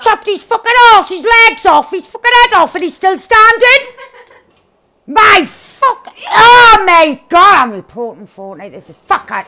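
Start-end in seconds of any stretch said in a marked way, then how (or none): 0.0-3.9 disbelieving outburst. Real, not acted. Disbelief at enemy surviving. 5.1-7.6 slow loud exasperated cry; outraged shout. Real, not acted.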